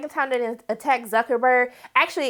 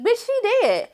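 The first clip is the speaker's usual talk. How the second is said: high, deadpan blunt line; high sassy deadpan; blunt friend; 11 semitones higher, slower than usual. Retold or imitated. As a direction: drop the news bluntly. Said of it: "And he was like".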